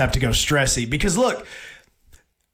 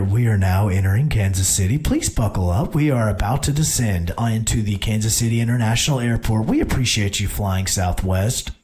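much lower, flat intercom voice; imitating flight announcement; 8 semitones lower than usual. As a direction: read it like the captain on the PA. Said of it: "I hear".

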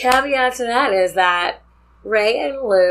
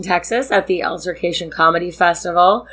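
normal talking voice

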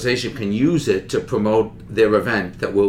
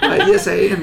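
laughing reply; 9 semitones higher, 6 dB louder than usual. Retold or imitated.

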